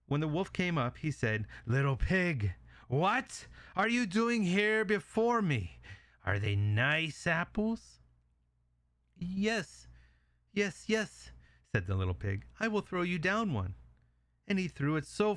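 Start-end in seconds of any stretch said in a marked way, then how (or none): 1.6-8.0 slow, high-swooping wolf voice; wolf catching out the pig. Acted. Wolf surprises pig in tree. 9.1-11.3 high, small pig voice; nervous little pig. Acted. Frightened pig answers. 12.6-13.8 high, small pig voice; nervous little pig. Acted. Pig tricks the wolf.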